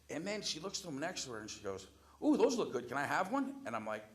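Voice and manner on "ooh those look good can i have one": high, loud, gushing imitation; eager cooing request. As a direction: coo over someone else's food. Woman eyeing another customer's food.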